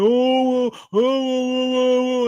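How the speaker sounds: high, drawn-out whiny oh's, mocking the rival; hammed-up whimper, stretched slow; mock whining. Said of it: "whining, complaining".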